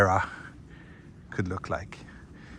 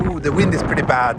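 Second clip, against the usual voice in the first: raised voice against wind; shouting over wind; 5 semitones higher, 7 dB louder than usual. Real, not acted. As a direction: project over the gusts. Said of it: "I hope you can hear me over this wind noise".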